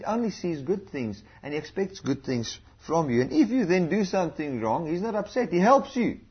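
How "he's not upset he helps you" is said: ordinary talk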